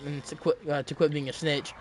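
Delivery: ordinary talk, nothing marked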